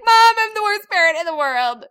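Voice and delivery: high, loud mock lament; mock wail. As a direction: wail it dramatically. Wailing to mom.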